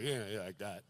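very low, very soft faint voice; imitating his weak dying father; dying dad. Dad answering his son from his deathbed, faint and low. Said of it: "And he was like".

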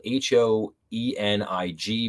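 "H O E N I G" letter by letter, slowly; spelled out. Shown as a demonstration. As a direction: spell "H O E N I G" as six separate letters. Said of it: "his name is spelled".

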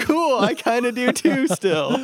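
high, loud, drawn-out mock enthusiasm; playful imagined reply. Joking to friend about a future chat abroad, playfully loud.

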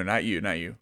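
ordinary delivery

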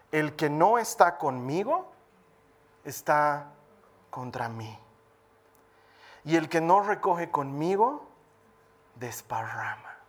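slow, solemn reading, falling to low; scripture reading. Acted. Preacher reading Mateo to congregation, solemn. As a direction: read the verse gravely.

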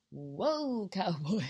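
long swooping whoa, breaking into a laugh; instructor's startled whoa. Retold or imitated. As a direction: draw out the whoa like reining someone in. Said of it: "driving instructor went".

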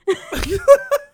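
high, loud laugh; burst of laughter. Real, not acted. Cracking up at her own risqué example, high loud laugh.

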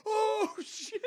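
high laughing mock gasp; prankster taunt. Prankster feigning shock between kids, high and laughing. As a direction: fake shock to start the fight. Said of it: "do the thing with the hand and go".

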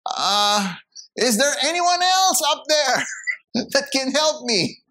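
high, doubtful call upward, with a laugh; comic second-guessing plea; sheepish climber. Acted. Climber asking for anyone else, high and sheepish. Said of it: "the man came back and says".

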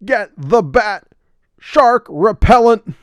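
booming, slow Batman impression; high, loud, slow hammed-up Batman; Adam West Batman. Batman quote, Adam West style.

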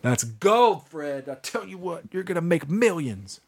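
high, put-on old-timey voice; old baseball announcer. Cheering on Fred about his lyric, old announcer voice. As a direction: play a mock vintage announcer. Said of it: "there goes that baseball announcer".

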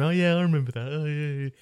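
high, put-on fond reminiscing; mock nostalgia. Imagined future viewer recalling lockdown, high mock-nostalgic voice. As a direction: send up a viewer fondly remembering.

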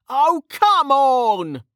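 high, drawn-out whine of protest; whining. A narrator's reading. Mage cheated of credit for the kill, whining. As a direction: whine it slowly in a high pitch. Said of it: "Kettle whined".

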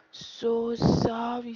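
a hammed-up, sing-song "So sorry"; drawn-out mock apology. Vlogger mock-apologising to listeners who lack Patois, loud and stretched.

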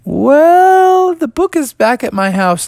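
very high, stretched-out "well"; hesitant. Acted. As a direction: stall sheepishly in a high voice. Froggy hedging to scary voice.

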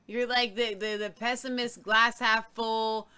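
ordinary talk, nothing marked

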